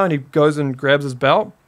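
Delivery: usual speaking voice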